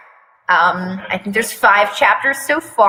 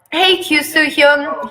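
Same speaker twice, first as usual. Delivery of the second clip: cute voice; 5 semitones higher than usual. Acted. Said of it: "he says in a cute voice".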